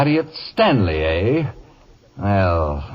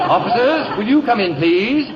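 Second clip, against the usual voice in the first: words bubbling with a gloating laugh, pitched higher; 7 semitones higher than usual. Acted. Whiteside calls the officers in, still laughing at beaten Stanley. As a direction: call the officers while still chuckling in triumph.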